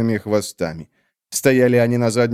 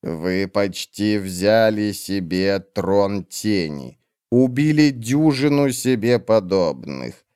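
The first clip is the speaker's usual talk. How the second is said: cold witch; 2 semitones lower, slower than usual. Acted. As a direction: drag each word out, icy and dismissive. Olar Ethil slow low.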